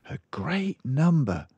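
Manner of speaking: mildly astonished librarian; a hint of amazement; astounded. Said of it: "said the astounded Missus Phelps".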